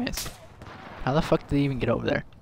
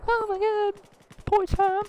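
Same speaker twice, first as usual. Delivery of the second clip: mock sad; slower than usual. Mock grief over teammate dying.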